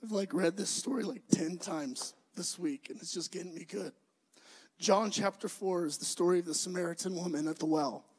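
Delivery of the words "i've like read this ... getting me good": choked up and much softer; getting emotional. Real, not acted. Pastor moved by the story she keeps rereading, choked up and quiet. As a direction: voice slipping into real tears. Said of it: "now I'm gonna get emotional".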